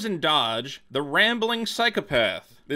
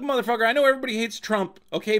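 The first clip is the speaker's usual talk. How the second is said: ordinary talk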